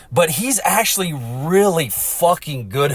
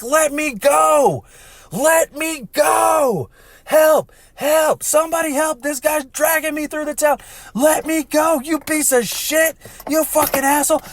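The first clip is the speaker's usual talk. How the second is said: imitated panicked screaming. Woman dragged by a horse, mock screaming for help. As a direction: scream like you're being dragged off by a rider. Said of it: "She's back there legit screaming".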